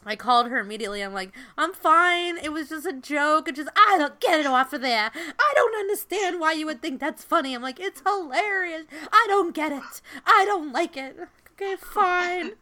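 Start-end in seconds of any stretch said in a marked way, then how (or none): 1.6-7.5 put-on much higher and louder panicked grandma in a thick New York accent; a frantic New York grandma, Queens accent, half laughing; grandmother's New York accent. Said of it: "Wait, what is that accent?". 7.8-12.5 put-on much higher and louder panicked grandma in a thick New York accent; a frantic New York grandma, Queens accent, half laughing; grandmother's New York accent. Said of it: "Wait, what is that accent?".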